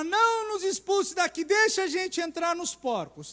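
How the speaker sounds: súplica mais aguda e alta; demônio suplicando. Retold or imitated. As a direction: implore como o demônio. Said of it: "o demônio vai sair e fala". (demônio pedindo para entrar nos porcos, aflito)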